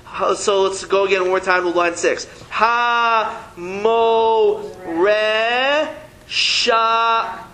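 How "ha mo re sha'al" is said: each word called out slowly and clearly; syllable reading. Shown as a demonstration. Teacher reads out the Hebrew line word by word for the class. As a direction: read the line for the class one word at a time.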